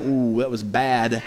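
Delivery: a low, soft, slow groan of worry, retold; retold sinking dread. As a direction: let the worry sink in, slowly and quietly. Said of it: "And then I started getting scared".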